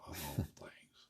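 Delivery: a chuckled whisper of disbelief; whispered laugh. Real, not acted. Amused aside to the interviewer, whispered with a laugh.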